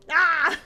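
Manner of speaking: loud exasperated "ah"; frustrated yell. Acted. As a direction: let out a worked-up cry. Yell caps the rant.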